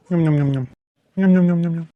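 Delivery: low mock chewing sounds, nyam nyam; munching noises. Father digs into crab curry, munching noisily. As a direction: smack the lips while eating greedily.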